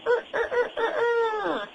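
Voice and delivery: high crowing rooster sound. Shown as a demonstration. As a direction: crow like a cartoon rooster. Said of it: "Does the rooster go".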